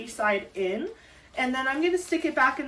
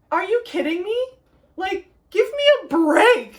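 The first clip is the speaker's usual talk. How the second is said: excited disbelief; 11 semitones higher, 7 dB louder than usual. Retold or imitated. Seeing the kit online, reliving an excited squeal. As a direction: squeal it like you can't believe it. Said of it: "I was like".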